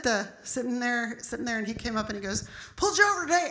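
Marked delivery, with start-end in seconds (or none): 2.8-3.5 high, loud mock yell; imitated officer shouting; screaming cop. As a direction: bark it like an irate traffic cop. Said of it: "just screaming".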